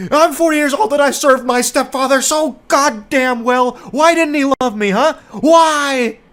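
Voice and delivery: high, loud whiny lament; hammed-up self-pity cry; mock wailing. Imitating the forty-year-old coworker begging for his stepfather's love, loud whine.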